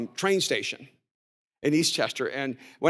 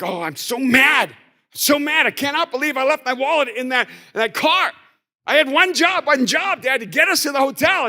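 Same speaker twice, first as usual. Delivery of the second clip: higher, louder frustrated outburst; imitating angry son; 8 semitones higher, 6 dB louder than usual. Son angry about the lost wallet in Manhattan, mock frustrated outburst. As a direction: vent like a kid mad at himself.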